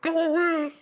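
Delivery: high, tiny voice behind a door; small muffled voice. Acted. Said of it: "seemingly small, muffled voice saying".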